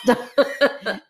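usual speaking voice

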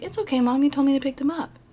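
a hint of a boy explaining himself; little boy. Boy to freaked-out mom over floating toys, lightly imitated child.

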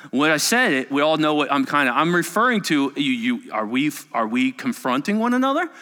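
ordinary talk

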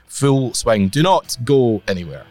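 usual speaking voice

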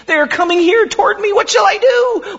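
high, rising panicked cry; a small voice in sudden fright; frightened. Acted. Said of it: "And in fear".

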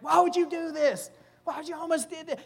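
high-pitched, indignant mock outrage; mad Pharaoh. Pharaoh confronts Abram over the deception, hammed-up anger. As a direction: act out an angry, disbelieving ruler, voice jumping up high. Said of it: "He's so mad".

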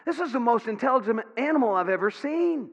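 high, astonished cry of stop, then excited; amazed bystander calling out; amazed onlooker. Retold or imitated. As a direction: blurt it out in wonder at the dog. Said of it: "he says".

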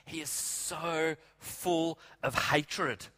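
usual speaking voice

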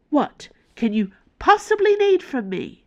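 high, disbelieving demand; exasperated assistant. Acted. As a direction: scoff at the very idea. Kitty voiced, exasperated.

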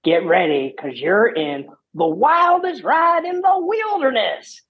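high, slow, far-off ride spiel; drawn-out frontier announcer call. Retold or imitated. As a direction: sell it like the ride's cowboy announcer. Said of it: "and then the guy says".

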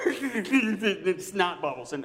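slow words through laughter; laughing. Real, not acted. Pastor laughing at himself in front of the church. Laughing at own ugly crying.

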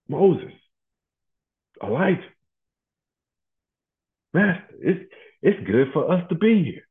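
high-pitched, awestruck outburst; Peter. Acted. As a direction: gush in a high, excited voice, as if just woken to a marvel. Acts Peter waking to see Moses.

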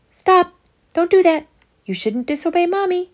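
high, loud urgent shout of Stop and Don't; urgent inner warning. Acted. The little voice in her head yelling at Emma to stop, high loud shout.

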